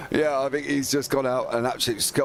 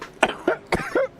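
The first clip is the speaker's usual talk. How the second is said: choking cough. Real, not acted. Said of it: "I've literally just nearly choked on my coffee".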